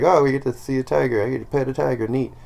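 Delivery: a hint of a child looking forward to a treat; little kid. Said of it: "As a little kid".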